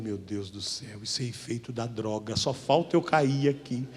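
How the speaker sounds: frightened murmur. Retold or imitated. Alarmed at a voice he hears, a soft low frightened murmur. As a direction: whisper it as if afraid to be heard.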